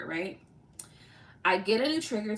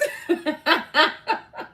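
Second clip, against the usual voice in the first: laughter; 6 semitones higher, 7 dB louder than usual. Real, not acted. Laughing at own joke.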